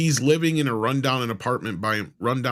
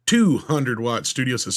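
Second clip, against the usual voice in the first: ordinary talk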